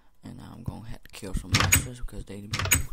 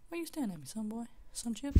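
much higher goofy squeak; high silly voice; 14 semitones higher, faster than usual. Acted. Gamer teasing a staring mob in a much higher goofy voice. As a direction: tease it in a squeaky voice.

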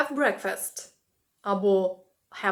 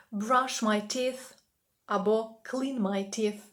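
slow model phrase; slower than usual. Shown as a demonstration. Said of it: "teacher models phrase".

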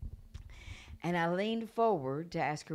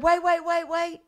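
high, loud repeated halt; urgent interruption; 12 dB louder than usual. Retold or imitated. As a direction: cut in with urgent shouts. Mother stops the excited preacher.

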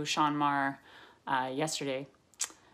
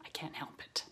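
whispering; 14 dB quieter than usual. Real, not acted. Unvoiced aside.

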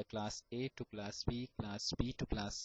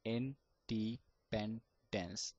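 very slow, Independence stretched out sound by sound; one by one; much slower than usual. Shown as a demonstration. Teacher to stammerers: Independence very slow, piece by piece. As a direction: tap out Independence slowly, one piece per finger. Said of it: "talk one by one letter of the word like that".

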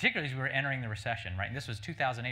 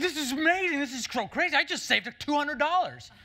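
much higher, loud ecstatic shopper; freaking out; 8 dB louder than usual. Retold or imitated. Shopper at checkout thrilled by her savings, high and loud. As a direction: shriek with delight over the bargain. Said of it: "she freaks out".

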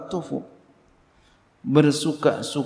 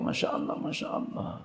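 hushed praise; 12 dB quieter than usual. Acted. Wife praising husband, hushed.

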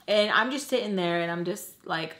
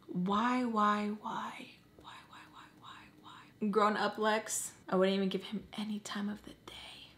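hushed, whispery questioning; whispered inner thought; 6 dB quieter than usual. Acted. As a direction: whisper it as if inside your own head. Voicing her thoughts to herself.

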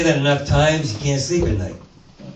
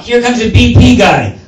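usual speaking voice

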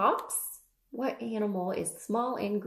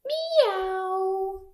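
high, long kitty cry; loud imitated mew; cat meow; 9 semitones higher, 5 dB louder than usual. Cat sound opens the cat page.

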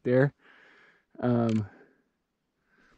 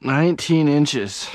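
excited; 3 semitones higher, 7 dB louder, slower than usual. Real, not acted. Announcing the measurement.

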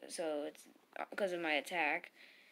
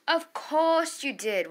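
loud, high, slow and stressed on "course"; indignant, raised-voice sarcasm; exasperated outburst; 8 semitones higher, 7 dB louder, slower than usual. Real, not acted. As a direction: hit "course" hard and stretch it out. Repeats it, more exasperated.

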